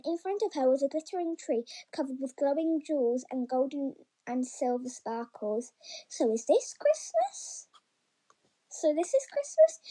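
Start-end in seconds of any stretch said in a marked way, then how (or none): 6.1-9.8 high, hushed wonder, not truly whispered; breathless amazement of a small rabbit; awed little rabbit. A narrator's reading. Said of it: "she whispered".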